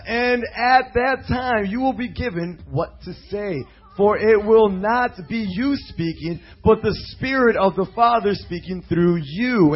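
loud, slow preaching declamation; scripture reading. Acted. Preacher reads the congregation a warning of arrest, declaimed scripture reading. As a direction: proclaim the verse, leaning on key words.

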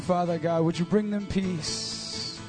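normal talking voice

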